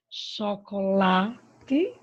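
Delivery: "chocolate" very slow, "la" long and loud; stress on LA. Shown as a demonstration. Teacher showing the strongest syllable, "la" stretched in "chocolate". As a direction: hold the "la" in "chocolate", then release "te". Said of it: "A sílaba mais forte é o lá".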